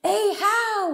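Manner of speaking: high, drawn-out call; calling to the fish. Acted. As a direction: call slowly out over the water. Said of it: "There's something we say to those fish".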